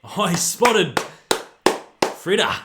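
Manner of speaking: loud, high burst of excitement; excited yell. Real, not acted. Fan yelling at a footy player on screen, excited. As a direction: shout as the player is spotted.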